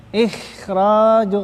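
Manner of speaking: ikhraju slow, the kh and r heavy and full-mouthed; thick tafkhim reading. Shown as a demonstration. Teacher models the correct heavy ikhraju for students, slow and thick. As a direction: draw out ikhraju with a thick, dark kh and r. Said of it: "Bukan ikh".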